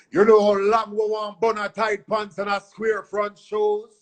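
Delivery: high put-on mimic of a dancehall deejay. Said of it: "I just went in there and mimicked [name]".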